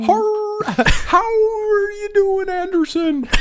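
high, drawn-out howled greeting; howling wolf voice. Acted. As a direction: howl the words like a wolf. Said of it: "first you hear".

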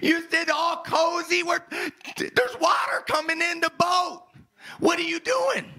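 high-pitched frantic yelling, acted out; panicked shouting. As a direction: shout like a disciple about to drown. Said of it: "the disciples woke him up shouting".

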